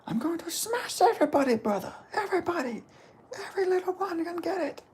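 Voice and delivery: very high, softened boastful voice; Hamza Chimaev. Acted. Imitating Hamza threatening everybody, high-pitched impression. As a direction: do the fighter's threat in a high put-on voice. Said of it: "the best I can get for a Hamza".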